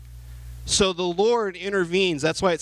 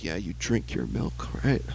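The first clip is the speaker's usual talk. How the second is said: sarcastic mutter; 11 semitones lower, 5 dB quieter than usual. Retold or imitated. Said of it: "She's like".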